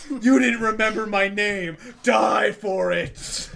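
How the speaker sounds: loud, drawn-out mock villain threat; villain shout. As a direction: shout it like a petty supervillain. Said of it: "Electro is like".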